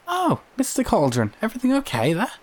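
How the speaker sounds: high, bright-pitched shopkeeper calling out; cheery high landlady voice calling across the street; Jeannie calling out. Acted.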